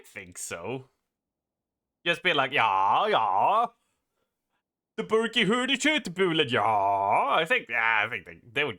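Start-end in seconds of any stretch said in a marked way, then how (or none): 2.5-6.6 sing-song mock Swedish gibberish; mock Scandinavian accent. Streamer making fun of Scandies, mock Scandinavian accent. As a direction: bounce through a caricature Scandinavian accent. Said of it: "get away with that accent".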